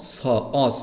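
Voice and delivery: hissing s in "অস", not sh; teacher demonstrates "অস" ending in a clean s; শ sounded as s. Shown as a demonstration. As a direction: say "স" and "অস" with a sharp dental s. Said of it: "স এর মতো উচ্চারণ করেছি".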